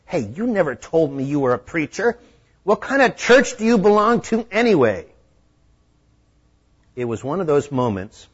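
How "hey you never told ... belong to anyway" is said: rising into a loud, high challenge; hostile. A narrator's reading. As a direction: confront the preacher, louder as you go. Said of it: "with a trace of hostility in his voice".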